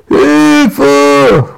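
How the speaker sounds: high, loud panicked cry; cry for help. Acted. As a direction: yelp it while fleeing. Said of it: "ruft Quark".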